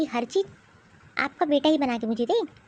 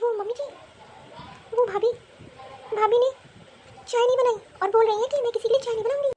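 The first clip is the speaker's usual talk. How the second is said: whiny tattling; 8 semitones higher than usual. Acted. Younger daughter-in-law tattles to mother-in-law.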